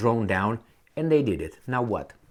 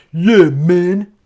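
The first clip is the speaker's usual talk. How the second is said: louder, higher, hearty "yeah, man"; 6 semitones higher, 11 dB louder than usual. Retold or imitated. Cheering agreement in a chat, loud. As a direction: belt it out like a buddy agreeing. Someone loudly agreeing in conversation.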